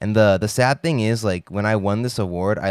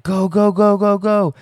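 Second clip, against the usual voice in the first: high, loud frantic go go go; panicked urging; 12 semitones higher, 8 dB louder than usual. Retold or imitated. Friends jumping in the car yelling at the driver, frantic. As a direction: yell at the driver to floor it.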